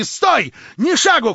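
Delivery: high, loud cry to stop him; shouted command. A narrator's reading. Verkhovensky shouting at Stavrogin to stop. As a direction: yell after him to halt. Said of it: "крикнул он".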